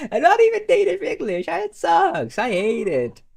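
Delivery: very high-pitched venting; exasperated squeal. Real, not acted. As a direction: squeal out your frustration with the language. Venting hatred of English.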